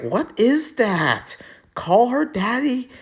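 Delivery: high, drawn-out mock disbelief; mock shock. Mimicking viewers shocked at podcast name.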